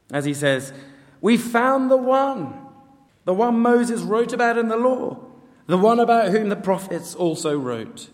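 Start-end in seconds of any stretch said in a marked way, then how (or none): 1.2-8.1 excited announcement. Acted. Philip telling his mate Nathanael about his discovery, excited. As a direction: share an amazing find, voice lifted high. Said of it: "he's obviously pretty excited".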